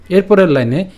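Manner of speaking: ordinary talk, nothing marked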